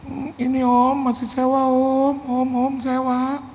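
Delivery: high, slow mock-crying voice; imitated tearful whine. Pastor admits church is still rented, mock tearful whine. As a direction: drag it out like you're about to sob. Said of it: "kenapa dia harus nangis gitu loh".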